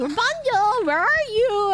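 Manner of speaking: high, hammed-up calling voice; mock villainess call; Mother Gothel. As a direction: holler for a missing daughter in a put-on voice. Mother Gothel calls for Rapunzel.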